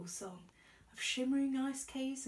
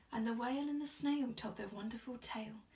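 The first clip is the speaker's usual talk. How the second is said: plain reading voice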